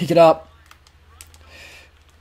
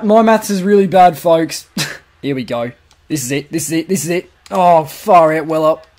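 ordinary talk, nothing marked

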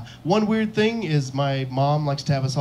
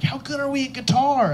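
put-on high, hopeful kid voice; eager eighth grader; 10 semitones higher than usual. Eighth grader questioning his future self, high and eager. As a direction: ask like an excited younger self, pitched way up. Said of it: "Eighth grade [name] might be the worst".

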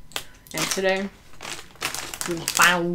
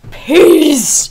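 loud sign-off yell; shouting; 8 semitones higher, 14 dB louder than usual. Real, not acted. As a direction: shout the goodbye. Outro sign-off.

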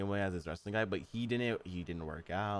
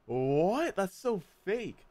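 mock outrage; 11 semitones higher, 4 dB louder, much slower than usual. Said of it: "I was like".

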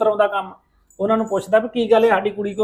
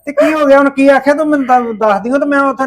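loud, raised fatherly outburst; agitated scolding; 5 semitones higher, 7 dB louder than usual. Acted. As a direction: demand answers in a raised voice. Father upset at daughter's news.

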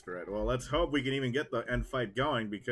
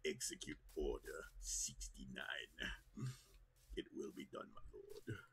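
low, quiet, whispered ending; dark lord voice; 10 semitones lower, 16 dB quieter than usual. Acted. Gamer repeating the Palpatine joke, low and soft. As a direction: murmur the order like a villain.

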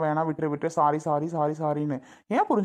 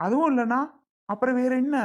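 exasperated; 6 semitones higher than usual. Acted. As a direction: throw up your hands, pitch rising. Husband exasperated by wife's sulking.

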